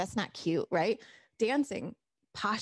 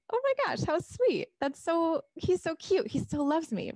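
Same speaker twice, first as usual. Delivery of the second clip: delighted gush; 8 semitones higher than usual. Retold or imitated. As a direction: squeal over how cute he is. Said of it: "The other one is".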